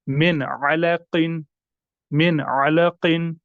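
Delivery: "मिन अलकिन" slow with न fully sounded; careful recitation; clear noon. Shown as a demonstration. Teacher recites example for students. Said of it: "हम पढ़ेंगे".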